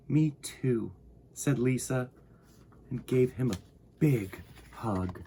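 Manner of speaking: gentle storybook narration for little listeners; reading to children. A narrator's reading. Host reading Corduroy to young viewers, gentle storytelling. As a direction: read it like bedtime story time. Reading picture book aloud.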